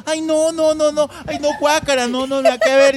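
high, whiny protest of no, no, no; squeamish refusal. Retold or imitated. Willy recoiling from a coworker's touch, high whiny protest. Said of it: "Y Willy".

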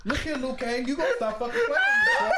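high laughing yell; mock shout. Shouting punchline.

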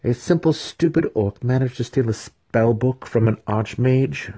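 high, incredulous questioning; rhetorical question. A narrator's reading. Student mocks his master's story, high rhetorical disbelief. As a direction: pitch it up in mocking disbelief. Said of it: "Massage whispered rhetorically".

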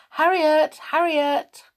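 high, loud call across the house. Acted. Elf calling her mouse servant into the room, loud high call. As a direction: summon her from another room.

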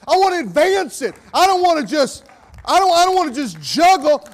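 loud, high impassioned preaching; fiery preaching. Acted. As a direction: preach it out full force to the congregation. Preacher at full force.

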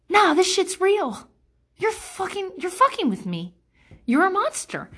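indignant realization. Retold or imitated. Confronting the passive-aggressive person in her head, high indignant. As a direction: snap out of self-doubt, outraged. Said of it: "you're like".

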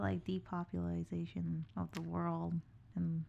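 whispering. Real, not acted. Conspiratorial aside.

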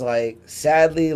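usual speaking voice

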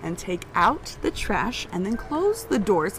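slow, over-enunciated instructions; condescending. Retold or imitated. Irritated woman explaining chores as if to someone slow, condescending. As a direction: spell it out slowly, as if they can't understand. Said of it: "She starts talking slow and like you can't understand the words".

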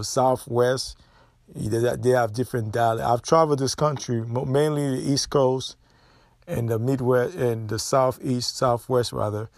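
ordinary delivery

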